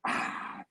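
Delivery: soft breathy "ah" after a sip; mock satisfied sigh; imitated refreshed exhale. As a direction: exhale like you just had a drink. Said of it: "he's like".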